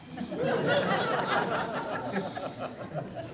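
high, soft laugh; amused real laugh. Real, not acted. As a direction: laugh at the silent crowd. Audience won't answer, she laughs.

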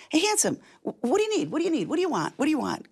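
mildly frantic, re-enacting asking what he needs; flustered questioning. Retells calling to her waiting husband.

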